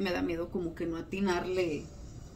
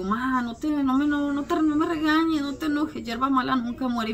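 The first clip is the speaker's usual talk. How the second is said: higher, coaxing plea not to be scolded; pleading with mom; 6 semitones higher than usual. Retold or imitated. Daughter coaxing her angry mom, higher pleading tone. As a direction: sweet-talk her out of being angry. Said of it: "Y mi mamá se enoja porque le digo".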